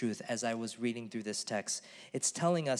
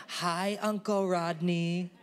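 imitating his little son; 4 semitones higher, 3 dB louder, slower than usual. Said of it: "my son calls me Uncle Rodney".

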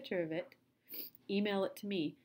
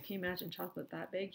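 ordinary delivery, nothing marked

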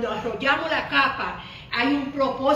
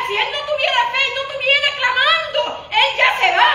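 even higher, loud, laughing climax; climactic preaching; 11 semitones higher, 6 dB louder than usual. Acted. Pastor repeating the point to the church at its peak, laughing climactic cry. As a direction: lift it to the peak of excitement. Second take, higher still.

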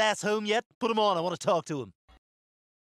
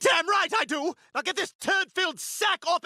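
shouting; 11 semitones higher than usual. Acted. Demands diaper off.

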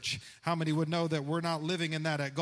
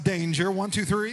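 shout; 3 semitones higher, 9 dB louder than usual. Shown as a demonstration.

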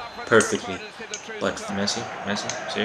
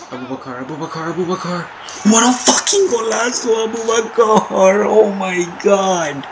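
real goal celebration, slowed and loud; building goal call; 10 semitones higher, 5 dB louder than usual. Real, not acted. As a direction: let it swell into a huge goal scream.

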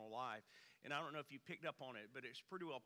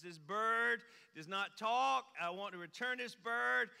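loud, indignant return demand; agitated complaint; 6 semitones higher, 9 dB louder than usual. Retold or imitated. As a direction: storm in and demand a refund. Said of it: "She's agitated".

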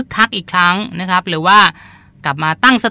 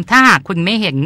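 usual speaking voice

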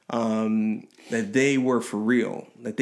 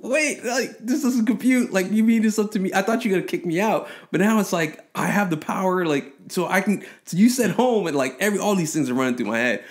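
excited disbelief; 9 semitones higher than usual. Retold or imitated. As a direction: replay the teen's racing thoughts, pitched way up. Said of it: "I'm like".

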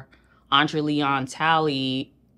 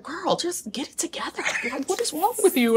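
teasing Serena; 12 semitones higher, 3 dB quieter than usual. Retold or imitated. Voicing Serena teasing her.

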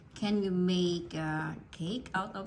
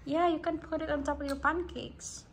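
higher sing-song insisting; playful insisting; 8 semitones higher than usual. Real, not acted. Parent repeats after child's refusal.

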